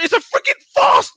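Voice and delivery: high, loud outraged yell; shouting that the match was a farce. Real, not acted. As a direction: blast it as an outrage. Heated football argument outburst.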